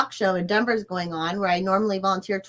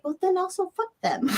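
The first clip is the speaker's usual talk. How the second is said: dismissive quote; 11 semitones higher than usual. Retold or imitated. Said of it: "But then I'm like".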